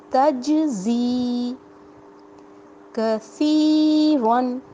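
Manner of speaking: تجزی with a whistling ز and کثیرن slow and stretched; whistling zay. Shown as a demonstration. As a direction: let the ز whistle and lengthen the vowels. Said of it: "سیٹی کی آواز آنی چاہیے".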